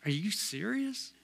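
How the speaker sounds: very soft, rising incredulous question; hushed disbelief. Retold or imitated. Doctors reacting to his camping plan, hushed disbelief. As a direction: imitate doctors quietly taken aback. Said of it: "they kinda looked at me like".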